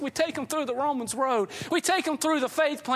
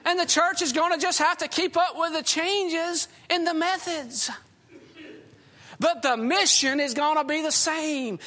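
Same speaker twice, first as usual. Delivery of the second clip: usual speaking voice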